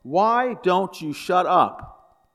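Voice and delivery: loud, high, drawn-out acted bark; fed-up husband's acted outburst. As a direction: bark it slowly at full exasperation. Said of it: "and barks".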